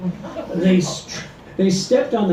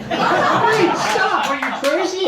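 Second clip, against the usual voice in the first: much higher, yelping in pain; cried out in pain; 13 semitones higher, slower than usual. A narrator's reading. Husband hit with a pan, yelping in pain. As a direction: yelp like you're being hit. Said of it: "Ed cried out in pain".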